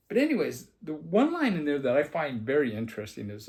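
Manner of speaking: ordinary talk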